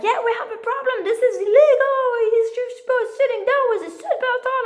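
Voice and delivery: mock the cop's outrage, loud on illegal; officer scolding a mother about her son, mockingly imitated; mocking angry cop. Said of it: "He's like".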